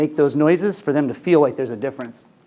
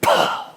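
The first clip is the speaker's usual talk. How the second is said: voiceless puff of air as the breath escapes; breathy burst, no voice. Retold or imitated. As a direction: blow out a sudden unvoiced "Pow". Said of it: "you'd go".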